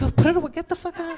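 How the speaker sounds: high, fast, panicked brush-off. Retold or imitated. Recoiling from the clerk's giant toy, high and panicked.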